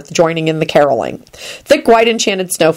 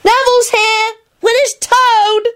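put-on very high child's call; high-pitched drawn-out yell; Teddy, yelling. A narrator's reading. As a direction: call up to another floor like a small boy. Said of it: "Teddy yelled from downstairs".

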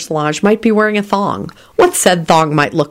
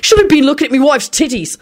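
put-on high, indignant Irish accent; Irishman bellowing; 9 semitones higher than usual. A narrator's reading. Irishman answering a stranger, bellowed Irish accent. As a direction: bellow it back in an Irish brogue. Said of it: "an Irishman bellowed back".

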